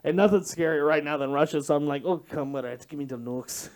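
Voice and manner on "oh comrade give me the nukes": low, soft mock Russian menace; a hint of a threatening Russian, murmured; Russian accent. Role play with Becca, mock Russian threat. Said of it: "make her feel threatened".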